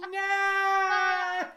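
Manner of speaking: high, loud mock yell of falling; mock scream. Brother falling into the thorns, a mock shriek of no. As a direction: shriek it like you're toppling backwards. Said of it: "you go".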